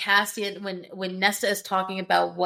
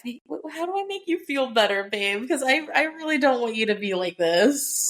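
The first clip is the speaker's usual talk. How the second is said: high-pitched mock pleading with a lover; anxious appeasing; 4 semitones higher than usual. Fan soothing an angry Cassian, anxiously appeasing. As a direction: plead sweetly, pitch lifted, trying to make it better. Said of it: "feels like I'm doing something wrong".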